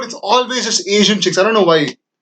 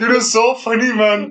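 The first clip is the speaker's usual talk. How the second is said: normal talking voice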